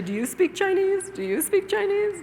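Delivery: high-pitched, mechanical repeated question; boring drill. Shown as a demonstration. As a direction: parrot it like a tedious classroom drill. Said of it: "It's very boring".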